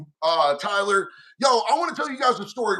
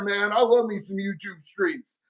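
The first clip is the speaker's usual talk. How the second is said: normal talking voice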